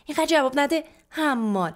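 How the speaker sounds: loud, high shout to shut her up; angry scolding. A narrator's reading. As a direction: shout down the back talk. Mother silences daughter's back talk. (تشر بلند و عصبانی)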